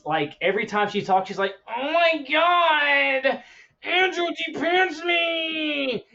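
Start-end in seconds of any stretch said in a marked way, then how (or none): 1.7-6.1 put-on high, slow, super whiny cracking girl voice; Lola. Cartoon girl talking to a boy, super whine. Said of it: "She's got this super whine".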